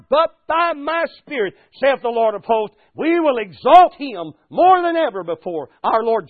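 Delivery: loud, high, rising preacher's build-up; preaching at full force. Acted. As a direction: lift the congregation, voice climbing.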